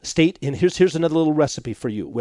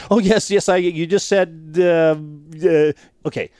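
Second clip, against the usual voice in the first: caught not listening; 3 dB louder than usual. Retold or imitated. Husband caught glazed over by wife, mock bluffing and stalling. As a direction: imitate a stumbling, faked attentive reply.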